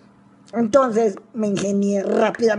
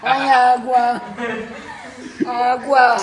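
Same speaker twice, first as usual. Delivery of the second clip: crying for water; 4 semitones higher, 5 dB louder, much slower than usual. Real, not acted. Mouth burning after eating soap.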